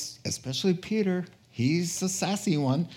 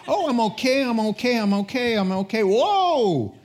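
nervous self-reassurance; 7 semitones higher, 4 dB louder than usual. Acted. Nervous Sabbath walker, acted.

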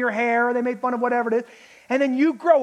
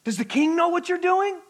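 higher, insinuating accusation; mock accusation; 5 semitones higher than usual. Enemies accusing Nehemiah before the king, insinuating. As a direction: accuse him with a sly threat. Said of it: "They are claiming".

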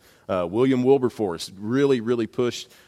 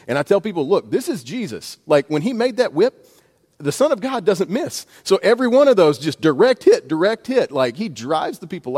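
rising preacher excitement; 8 semitones higher, 7 dB louder than usual. Real, not acted. Preacher builds excitement about Jesus's whip.

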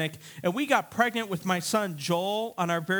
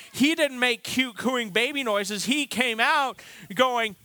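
usual speaking voice

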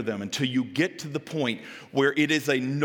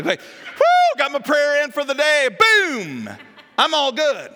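loud, high whoop of job done; mock triumph; 12 semitones higher, 9 dB louder, slower than usual. Busy person finishing a token prayer, mock triumphant. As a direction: celebrate it with hammed-up relief.

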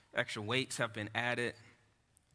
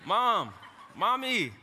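put-on high loud calling of a small boy; child calling out; 10 semitones higher, 9 dB louder, slower than usual. Preacher imitates son Levi calling mom, high loud wail. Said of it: "like a bad Family Guy skit".

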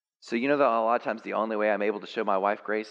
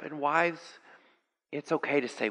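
ordinary talk

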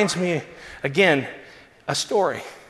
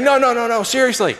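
higher and louder, eager protest; a star brushing off an apology, higher and louder; earnest insistence; 9 semitones higher, 5 dB louder than usual. Retold or imitated. Costner insisting warmly.

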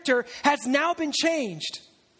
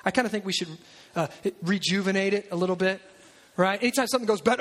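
usual speaking voice

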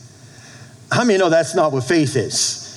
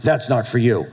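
ordinary delivery

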